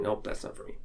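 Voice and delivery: soft breathy brush-off; a dismissive aside, barely voiced; mock dismissal. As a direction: shrug it off under your breath. Imagined client dismissing it.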